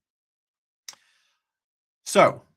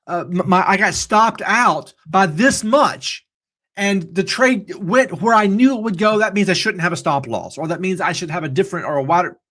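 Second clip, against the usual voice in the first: higher, louder, slowed-down whining trader; mock-dismayed trader complaining about a stop loss; frustrated trader; 5 semitones higher, 4 dB louder than usual. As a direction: play a trader moaning about a stop-out, pitched up. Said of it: "and you're gonna say".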